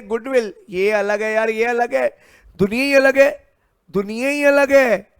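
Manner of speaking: high, loud, rapid insistence; worked-up teacher hammering the difference; emphatic insistence. Real, not acted. Teacher insisting the two are separate.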